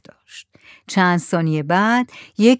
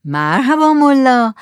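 loud, drawn-out, raised hail; hearty greeting; 4 semitones higher, 6 dB louder, slower than usual. Acted. Headman greeting the mullah, hearty drawn-out hail. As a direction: stretch the greeting out loudly.

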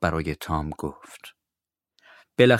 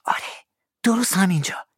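unvoiced hush; whisper; 11 semitones higher than usual. Tom confirms the spot to Huck, whispering.

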